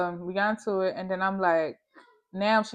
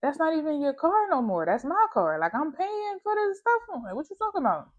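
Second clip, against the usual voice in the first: indignant retort; 9 semitones higher than usual. Retold or imitated. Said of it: "I'm like".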